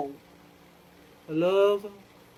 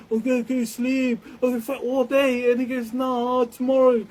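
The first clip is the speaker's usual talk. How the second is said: loud and raised, dismissive; retold shouting; 5 semitones higher, 9 dB louder than usual. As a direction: yell it off like brushing someone away.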